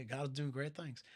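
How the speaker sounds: put-on soft, light Southern accent; a touch of Southern accent. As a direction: quietly imitate a Southerner. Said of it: "That's my southern accent".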